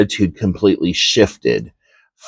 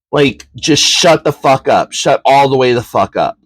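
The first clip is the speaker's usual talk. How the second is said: raised, heated telling-off; pitched-up furious rant; retold angry outburst; 4 semitones higher than usual. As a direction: finally snap at the bully. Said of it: "And I said".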